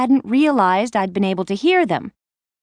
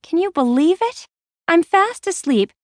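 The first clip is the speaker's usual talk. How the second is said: usual speaking voice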